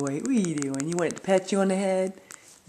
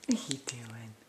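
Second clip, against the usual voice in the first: soft breathy whisper to the pet; 6 semitones lower, 7 dB quieter than usual. Real, not acted. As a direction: hush it gently. Whispering to the bird.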